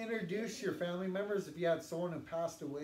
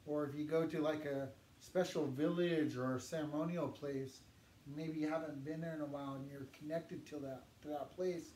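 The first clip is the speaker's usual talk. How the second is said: ordinary talk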